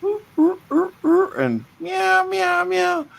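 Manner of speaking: high squeaky cat and dog whines; high pet whining. Retold or imitated. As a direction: mimic pets begging, very high. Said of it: "keep coming up to me like".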